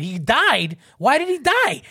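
incredulous echo climbing to a high, loud question; imitated shocked child. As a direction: question it like an astonished kid. Children react in disbelief.

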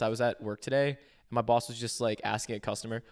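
ordinary delivery

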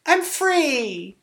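very high, loud triumphant cry; gleeful cry. Pretend. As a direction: shriek with joy at freedom. Acting escape, laughing.

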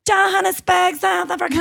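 very high, hammed-up Aussie voice-over; Australian announcer. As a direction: proclaim it as a mock Australian announcer. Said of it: "that's my Australian voice".

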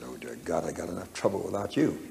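hushed, weary whisper to God; whispered aside. Retold or imitated. Preacher retells whispering to God about the weeping visitor, hushed. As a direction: breathe it out as a private prayer under the breath.

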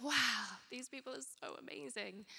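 lightly voiced awed child; a hint of a wide-eyed kid; awestruck kid. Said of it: "you're like".

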